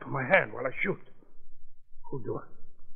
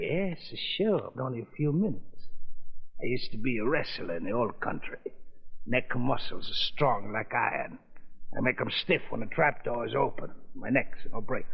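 put-on thick foreign-accented gangster, taunting; menacing mobster in broken English; lightly accented mob boss toying with the judge.

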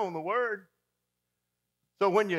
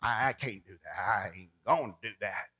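lower, muttered excuses; mock stubborn refusal; 6 semitones lower than usual. Churchgoer refusing a task, lower grumble. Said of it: "How many times have you said".

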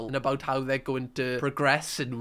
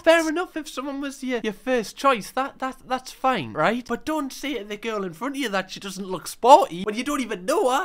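louder, higher indignant rant; 7 semitones higher, 5 dB louder than usual. Real, not acted. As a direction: rant at him, getting louder. Ranting at contestant.

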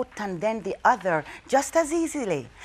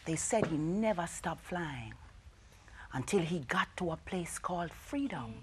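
soft, unvoiced reverent whisper; hushed whisper; 4 semitones lower, 16 dB quieter than usual. A narrator's reading. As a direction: whisper it like a secret. Reaching Freedom.

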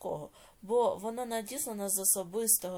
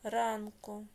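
slow, drawn-out dictation with long pauses; 2 semitones lower, slower than usual. Shown as a demonstration. Teacher dictating a note to pupils, slow measured dictation. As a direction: dictate word by word, stretching syllables for writers.

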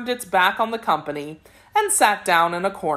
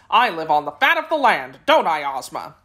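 plain reading voice

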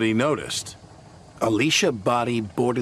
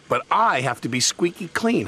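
raised, self-important huff; huffy; 6 semitones higher than usual. A narrator's reading. Thomas refuses to give Percy the washdown, mildly huffy. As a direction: insist with mild huffiness. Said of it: "huffed Thomas".

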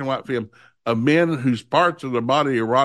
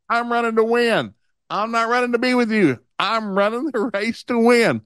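retold parental talking-to, pitched high; emphatic lecturing; 6 semitones higher than usual. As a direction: lay down the law in a raised pitch. Father lays down rule to daughter.

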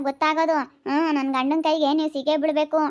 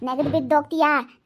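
ordinary talk, nothing marked

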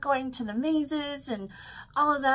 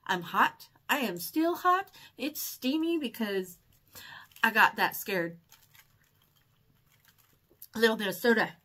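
ordinary talk, nothing marked

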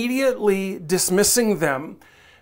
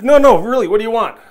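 louder, higher, pressing; insistent friend; 3 semitones higher, 10 dB louder, faster than usual. Retold or imitated. Friend pressing others about supper, loud and insistent. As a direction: push the friends for an answer.

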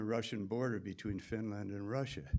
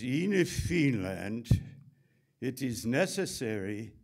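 slow, loud, stern official announcement; stern Finnish voice; 3 semitones higher, 7 dB louder than usual. Retold or imitated. A voice behind him in the sauna, stern and slow. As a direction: boom it out slowly like a stern sauna rule.

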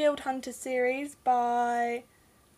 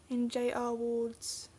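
usual speaking voice

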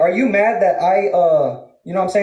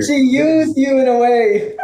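laughing; 5 semitones higher, much slower than usual. Real, not acted. Mocking laugh at rival.

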